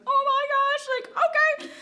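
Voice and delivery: very high, loud squeal of shock; mock-overwhelmed high-pitched outburst; floored. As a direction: squeal it, acting out being stunned. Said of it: "I'm, like, floored".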